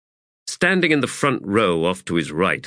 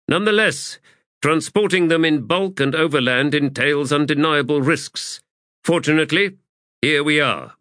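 higher, projected formal officer's voice; High Fist addressing troops; 6 semitones higher than usual. A narrator's reading. High Fist explains the munitions to assembled soldiers, formal projected address. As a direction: commander making a measured public address.

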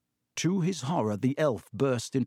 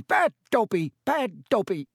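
high, loud squeaky self-scolding; shouting; 8 semitones higher, 3 dB louder than usual. A narrator's reading. Elf banging his head on the window, shouting. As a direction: punch out each word in an elf squeal.

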